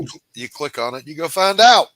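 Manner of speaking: put-on explaining like to kids; teacher voice. Shown as a demonstration. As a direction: talk down like a schoolteacher. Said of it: "boys and girls".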